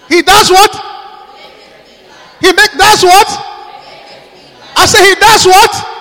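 loud, high demanding call; preaching call. Acted. Preacher demanding the congregation answer, loud and high. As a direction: push the crowd for the answer.

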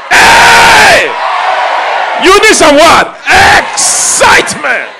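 shouted, high-pitched call to the church; a fiery preacher's cry for excitement; full-force preaching. Real, not acted. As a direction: rouse the crowd with loud cries. Preacher rouses the congregation.

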